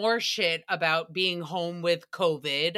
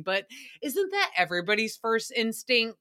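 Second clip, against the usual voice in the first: ordinary talk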